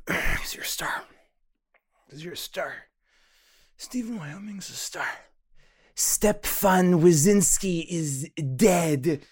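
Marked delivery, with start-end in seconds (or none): 0.1-1.0 loud stage whisper. Acted. Whispered self pep talk. 5.9-9.2 slow, booming shout; loud slow declaration. Acted. Actor's self-affirmation shout.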